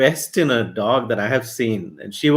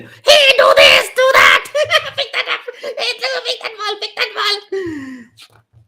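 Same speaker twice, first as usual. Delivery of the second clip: put-on very high, laughing, shouted child's orders; bossy little monster; 4 dB louder than usual. A narrator's reading. Spoiled boy ordering the dog around, high and giggly. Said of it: "little monster at home who was like".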